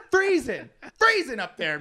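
cold storyteller hamming it up; laughing exaggerated freezing. Real, not acted. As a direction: make the cold sound extreme. Recalling the cold.

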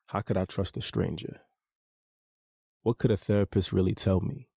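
usual speaking voice

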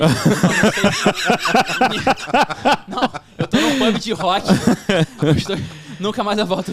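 loud laughing burst; laughing loudly. Real, not acted. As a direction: cracks up, shouting through laughter. Guest jokes, laughing loudly.

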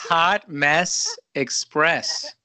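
very slow, loud, word-by-word echo; drawn-out amused announcement, each word set apart; savouring repeat. Retold or imitated. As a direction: relish each word of the title. Host savours her funny title.

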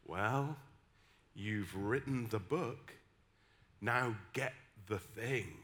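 low, slow, hushed minister's voice; quiet, measured pronouncement; woman minister. Retold or imitated. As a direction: deliver it softly and weightily, word by word. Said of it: "woman minister, came up to me".

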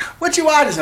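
put-on high-pitched curious kid question; imitated daughter. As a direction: pipe up as the daughter. Voices daughter's question in anecdote.

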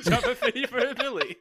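high, laughing through the words. Real, not acted. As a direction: laugh while saying it. Laughing repeat of title.

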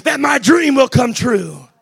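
rising, shouted sermon cadence; preaching at full force. Acted. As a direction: preach it loud with the organ behind. Preacher building to climax.

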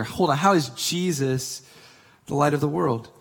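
ordinary delivery

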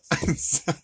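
ordinary talk, nothing marked

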